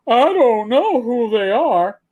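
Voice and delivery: slow, loud, exaggerated innocence; mock innocence. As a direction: play it up like a legal disclaimer. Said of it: "we'll just say".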